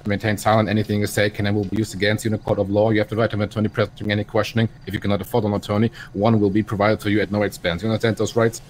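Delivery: ordinary talk, nothing marked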